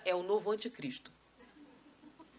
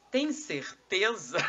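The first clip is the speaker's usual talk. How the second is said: slow, loud teacherly challenge; good teacher; 5 dB louder, much slower than usual. Acted. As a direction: draw out the question like a testing teacher. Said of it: "um bom professor diz".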